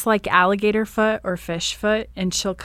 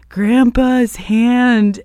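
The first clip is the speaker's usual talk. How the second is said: higher, louder and very slow in a put-on voice; fuller creepy kid impression; 5 semitones higher, 8 dB louder, much slower than usual. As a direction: ham up the spooky little girl this time. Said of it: "do a better impersonation".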